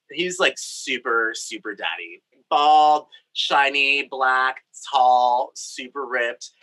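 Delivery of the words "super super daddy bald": ordinary talk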